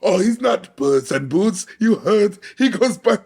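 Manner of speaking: high, slow, drawn-out character voice; hammed-up impression making fun of himself; Antonio Banderas. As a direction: pitch it up and stretch the words mockingly. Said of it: "shout out to Antonio Banderas' incredible, inimitable voice".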